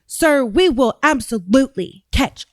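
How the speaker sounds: slow, over-deliberate official cadence; mock solemn vow, each word spaced out; Pam Bondi. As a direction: drag it out as if addressing small children. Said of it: "This is how she talks".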